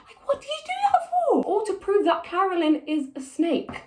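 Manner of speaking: high-pitched, incredulous complaint; exasperated questioning. Real, not acted. Challenging the writers over the ending, exasperated.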